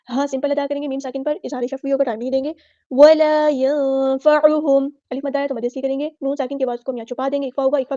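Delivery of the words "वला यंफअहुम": slow melodic chant, drawn out; Quran recitation. Shown as a demonstration. Teacher models the verse for students, chanting. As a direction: intone the verse like a qari.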